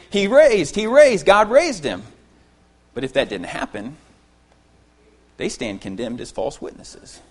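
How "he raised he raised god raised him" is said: loud, high, ringing proclamation; apostles proclaiming. Retold or imitated. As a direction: cry it out like a witness. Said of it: "they're over here saying".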